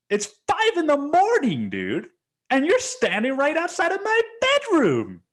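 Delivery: very high, loud indignant rant; exasperated, high-pitched re-enacted outburst; outraged yelling. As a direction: yell at the offender, pitch shooting up.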